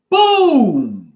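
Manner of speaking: loud, high, drawn-out boom; booming exclamation. Pretend. Man mimicking a blast, loud boom. Calls out an explosion sound.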